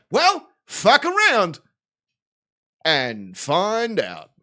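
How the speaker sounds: slow, smug retold retort to the crowd; smug slow comeback. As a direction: lay it down slow like a mic drop. His own cocky retold reply.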